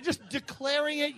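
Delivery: usual speaking voice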